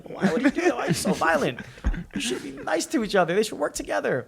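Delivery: high-pitched naive complaint; mock naive pleading. Naive kid misreading Omar's violence.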